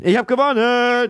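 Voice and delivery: high triumphant shriek; shrieking jackpot winner. Real, not acted. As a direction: screech the win like a jackpot. Shrieks after winning the game.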